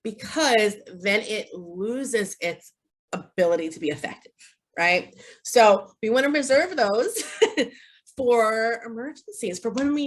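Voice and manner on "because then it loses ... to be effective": usual speaking voice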